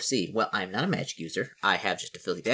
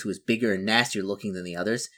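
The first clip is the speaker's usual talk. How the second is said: usual speaking voice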